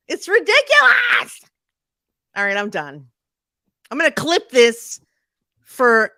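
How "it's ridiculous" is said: high, loud outcry; loud exclaim. Acted. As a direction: belt it out in outrage. Repeats loudly as ad clip.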